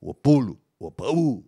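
loud, emphatic grandparent correction; grandparent correcting. Retold or imitated. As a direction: imitate an elder loudly fixing a mispronunciation. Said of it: "grandma or grandpa would say".

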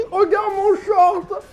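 talking with a burning mouth; words slurred by a burning tongue. Real, not acted. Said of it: "Je sens plus ma langue".

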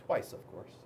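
soft, voiceless aside; a whispered little joke to the listener. A narrator's reading.

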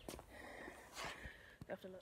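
slow unvoiced whisper. Real, not acted. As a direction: breathe it out slowly. Replay check, then hushed repeat.

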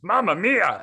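loud, high mock Italian cartoon guy; Italian character voice. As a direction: ham up a spaghetti Italian. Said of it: "I'm doing it in a character voice too".

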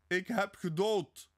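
high, slow, ringing cry; loud proclamation. A narrator's reading. Sonja shows him how to confess, loud proclamation. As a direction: proclaim it to the whole world. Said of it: "zeg luide".

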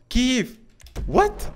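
very high, stunned cries; shocked. Real, not acted. Gamer shocked the same stranger returns. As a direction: disbelief at the same stranger again.

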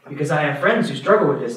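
ordinary delivery

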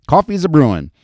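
high, loud and cheery; cheerful call. Acted. Betty cheerfully announcing coffee while bustling about.

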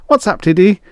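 high, loud eager voice; breezy assistant. Acted. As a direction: pop in cheerfully.

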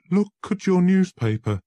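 high, loud, anguished; desperate lawyer begging Holmes for help; desperate plea. Acted. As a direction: plead for help, panicking. Visitor with shaking hands.

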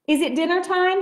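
high, loud bellow across the field. A narrator's reading. Sheep bellowing to Moose across the hillside, loud and high. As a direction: call it out big and high. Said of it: "he bellowed".

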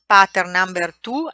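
ordinary talk, nothing marked

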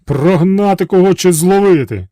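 a hint of booming genies; genies awaiting orders. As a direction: ask the master for a command.

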